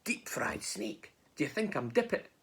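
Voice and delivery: slow, hushed disbelief; alarmed snake. Acted. Snake hearing it is the favourite food, hushed alarm. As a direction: whisper it in stretched-out dismay.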